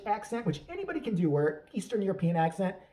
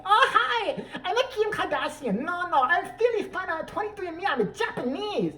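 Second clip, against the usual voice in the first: high, hammed-up fake Japanese accent with laughs; mock Japanese accent; 10 semitones higher than usual. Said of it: "as stereotypical and as obnoxious as we can".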